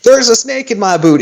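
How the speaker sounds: high-pitched Southern cowboy catchphrase; southern accent. Shown as a demonstration. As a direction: practice a high cowboy twang. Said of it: "you just need to practice".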